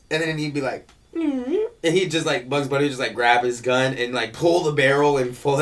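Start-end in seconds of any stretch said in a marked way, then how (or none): 1.1-1.5 cartoon voice. Imitating cartoon character.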